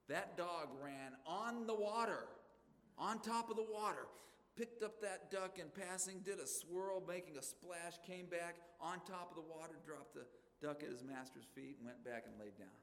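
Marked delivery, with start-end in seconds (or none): 0.1-9.7 amazed telling. Acted. Preacher telling his congregation the miracle dog story, with loud, high-pitched amazement. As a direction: build wonder as the dog runs on the water.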